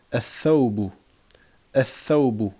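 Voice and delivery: "ath-thawbu" with a long doubled th, no L; doubled first letter. Shown as a demonstration. Teacher drilling sun-letter words, doubled th. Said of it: "on va doubler".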